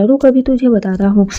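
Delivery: usual speaking voice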